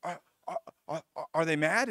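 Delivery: acted panic, high and loud, then stammering; flustered, high-pitched outburst breaking into stutters; panicking. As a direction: imitate a panicking housemate tripping over words. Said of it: "he starts panicking".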